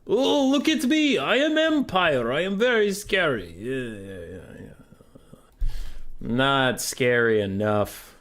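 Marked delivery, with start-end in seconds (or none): 0.1-3.6 high, hammed-up boastful voice; mock scary Empire. Streamer mocking the enemy Empire army, high hammed-up boast. 3.6-5.7 mock whisper. Trailing off after mock boast.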